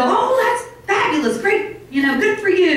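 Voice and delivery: hammed-up cheerful congratulations; imitating a delighted friend, high and bright; gushing praise. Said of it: "Some people go".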